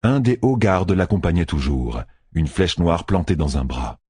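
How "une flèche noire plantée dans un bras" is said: ordinary delivery, nothing marked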